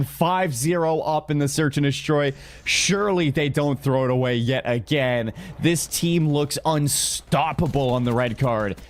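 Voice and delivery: ordinary delivery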